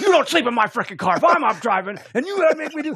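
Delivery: high, loud ranting scold; hammed-up furious yelling; freaking out. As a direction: re-enact an outraged driver's tirade. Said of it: "I was, like, freaking out".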